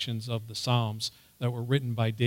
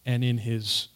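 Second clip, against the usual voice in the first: usual speaking voice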